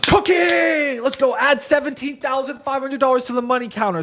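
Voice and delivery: high hyped celebration; excited. Real, not acted. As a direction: celebrate the win out loud. Celebrating a closed deal.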